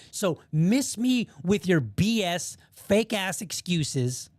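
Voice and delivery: each word slowly spelled out; slow and pointed. Real, not acted. To the critics, slow and deliberately pointed. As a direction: lay it out slowly, daring them.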